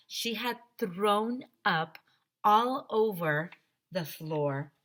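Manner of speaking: usual speaking voice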